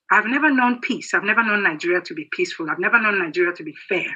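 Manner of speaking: usual speaking voice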